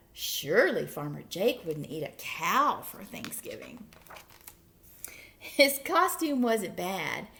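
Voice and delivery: usual speaking voice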